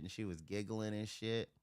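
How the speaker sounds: ordinary delivery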